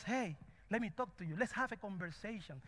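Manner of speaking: softly, as if in private; gentle casual invitation. Acted. Preacher as God inviting us to a conversation, soft and casual. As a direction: drop to a quiet, conversational voice.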